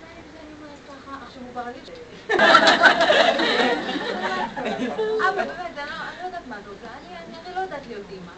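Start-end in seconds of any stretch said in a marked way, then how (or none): 2.0-5.2 big burst of high laughter; sudden laughing outburst; loud laugh. Real, not acted. Laughs at her own story.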